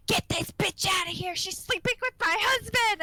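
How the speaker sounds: high-pitched jealous-wife outburst, hammed-up; mock outraged yell. Patient accusing coworker of sleeping with husband, mock outrage. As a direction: imitate a furious patient demanding someone out.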